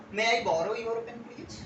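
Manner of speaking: "May I borrow your pen please" drawn out slowly; slow polite model. Shown as a demonstration. Teacher modelling polite borrowing slowly. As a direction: say it slowly, word by word. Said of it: "मैं क्या बोल सकता हूँ".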